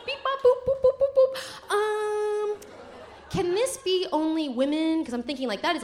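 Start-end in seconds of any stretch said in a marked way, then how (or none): 0.1-1.7 high robotic beeping; robot beeps. Retold or imitated. [name] imitating her brain checking in, high robot beeps. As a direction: beep like a computer.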